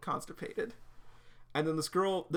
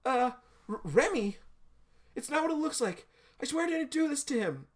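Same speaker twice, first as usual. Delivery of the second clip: high, louder stammering protest; flustered panic; 11 semitones higher, 6 dB louder than usual. Acted. Girl caught by Remy with the fallen guy, panicked. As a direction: plead innocence in a high panicked voice.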